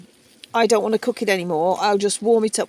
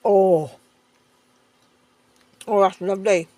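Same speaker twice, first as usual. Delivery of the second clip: talking through a mouthful; words round a bite of food; mouth full; 5 dB louder than usual. Real, not acted. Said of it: "talking with my mouth full".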